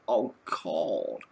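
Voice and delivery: a short put-on Lorne groan of a phrase; imitating Lorne. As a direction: toss off his sigh of a catchphrase. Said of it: "I can say".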